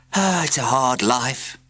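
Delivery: slow, self-pitying moan; pathetic sigh. A narrator's reading. Postman pities himself to the class, slow and pathetic. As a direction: sound sorry for yourself. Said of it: "he added pathetically".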